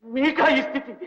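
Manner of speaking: distraught lament. Acted. Grieving over master's condition.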